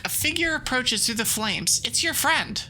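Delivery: ordinary delivery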